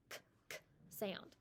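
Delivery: unvoiced k, k with a soft breath; whispered k sound. Shown as a demonstration. Teacher to kindergarten class, showing the k sound in a whisper. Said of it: "the first sound that you heard me make".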